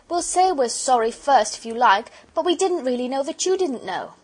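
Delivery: put-on high, loud, apologetic child; pleading girl. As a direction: coax him as a sorry little girl.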